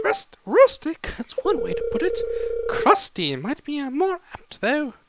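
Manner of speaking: high-pitched incredulous quibble; carpenter character, scoffing. Acted. Carpenter disputes the word rustic, high scoffing voice.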